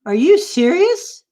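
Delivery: high-pitched, astonished dog; incredulous joke dog. Acted. Dog hearing the cat's news, high-pitched disbelief. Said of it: "And he says".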